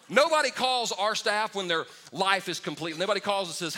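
ordinary talk, nothing marked

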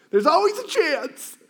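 high, laughing aside. Real, not acted. Laughing aside after Vikings joke.

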